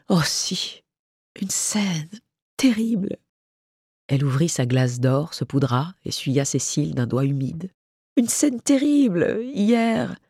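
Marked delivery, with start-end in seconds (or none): 0.1-3.2 halting, higher-pitched confession; subdued, tearful confirmation; hushed distress. Acted. Tearful friend confirms the quarrel. 8.2-10.2 high, drawn-out lament; slow, aggrieved wail in words; plaintive distress. Acted. Friend retells the lovers' quarrel plaintively.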